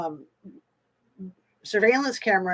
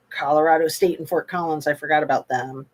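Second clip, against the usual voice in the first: normal talking voice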